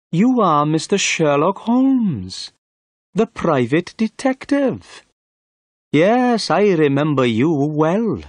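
high-pitched, self-satisfied inspector; high, emphatic character voice; pompous police inspector. A narrator's reading. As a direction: play the inspector sizing up a rival, pitched high.

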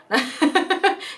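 high laugh. Real, not acted. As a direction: laugh at the punchline. Laughs after punchline.

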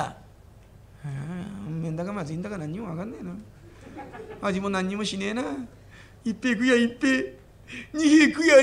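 a gracious host speaking gently; polite old innkeeper. Acted. Innkeeper addresses guest.

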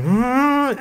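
high mock-rousing hum; imitated rousing hum. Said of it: "rousing m-, racial moment again".

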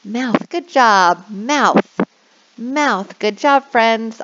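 put-on bright, slowed teacher voice modelling words for kids; upbeat online English teacher; cheery teacher voice. Shown as a demonstration. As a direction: coax little kids to repeat the word. Demo of online class teaching.

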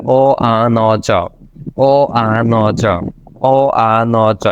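ও আ ন চ as separate drawn-out sounds; sound-by-sound breakdown. Shown as a demonstration. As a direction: spell out ও, আ, ন, চ slowly, one by one. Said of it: "একসাথে করলে". (ও আ ন চ আলাদা আলাদা করে ধীরে)

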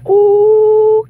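high, loud ooh; surprised ooh. Real, not acted. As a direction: gasp it out, delighted. Free ticket revealed.

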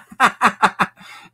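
high mocking cackle, turning away; dismissive high laugh. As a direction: laugh her off, shrill and loud. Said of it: "I just turned and went".

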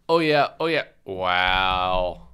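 deadpan imitation of monotone moaning; mock monotone. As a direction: drone it with no excitement at all. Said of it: "in the same monotone".

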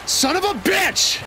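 very high mock shout; high-pitched imitation of a cursing fit; quoted outburst. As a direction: yelp it way up high. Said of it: "Another one is".

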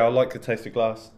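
usual speaking voice

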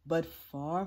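ordinary delivery

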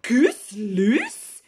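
lightly yawned, puzzled question; sleepy farmer. Acted. Sleepy Farmer Jolly answering the phone, yawning. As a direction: yawn the question half asleep. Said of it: "yawned sleepy Farmer Jolly".

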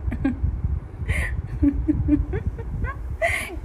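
breathless laughing; airy real laugh; real laughter. Real, not acted. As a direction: still cracking up. Still laughing.

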